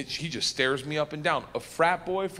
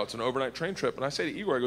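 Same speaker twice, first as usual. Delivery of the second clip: usual speaking voice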